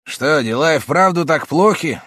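high, loud concerned uncle. A narrator's reading. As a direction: ask the sick friend with concern. Uncle asks sick lawyer.

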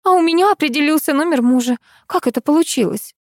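puzzled, high-pitched wonder; amazed. A narrator's reading. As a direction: ask in mild amazement. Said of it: "изумилась супруга режиссёра". (удивлённо, высоким голосом)

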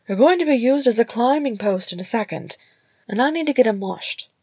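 brisk sisterly warning; lifted, no-nonsense character voice; brisk mother. A narrator's reading.

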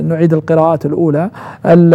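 normal talking voice

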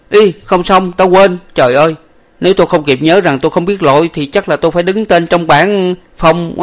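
high, loud flustered outburst; panicked servant. A narrator's reading. Servant remembering he cannot swim, in a panic. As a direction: wail in alarm at your own mistake. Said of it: "Lục Tặc hoảng kinh".

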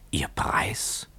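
whispered, rising in disbelief; stunned whisper. Acted. Young man staring at Keawe as at a ghost, whispering. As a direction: breathe it out, barely voiced.

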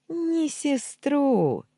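high, loud, very drawn-out taunt; gloating. A narrator's reading. Uncle taunts nephew on the way out, gloating. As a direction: crow it in mocking triumph. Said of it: "в голос рассмеялся он".

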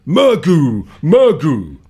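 loud high chant, falling at the end; shaman's call. A narrator's reading. A shaman greets the god Erlik with a loud chanted call. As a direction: call out like a Siberian shaman at a god's door. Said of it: "and says".

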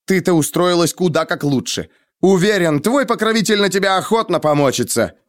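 high-pitched spiteful jibe; withering sarcasm. A narrator's reading. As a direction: wound her with biting scorn. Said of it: "уничтожающе бросил я". (едко и уничтожающе)

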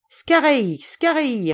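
high, loud command to hurry; emphatic cry, each word thrown out separately; forceful repeated shout. Acted. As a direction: call it out hard to the coachman. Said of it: "Dérigny répéta avec force".

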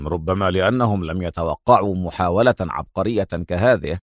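low male character voice, interested; quiet, deep, attentive reply. A narrator's reading. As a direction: offer a thoughtful guess in a deep register. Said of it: "قال في اهتمام".

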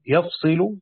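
يفصل said yafsilu, with an open ya and a light sad under kasra; reading of Asim and Yaqub. Shown as a demonstration. Teacher shows students the Asim reading: يفصل as yafsilu. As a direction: say يفصل as yaf-si-lu with no doubled letter. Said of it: "بفتح الياء وكسر الصاد مخففا".

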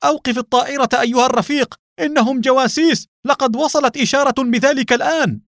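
urgent high-pitched shout; alarmed soldier crying out, very high. A narrator's reading. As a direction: yell a frantic warning at top pitch. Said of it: "وهو يصيح".

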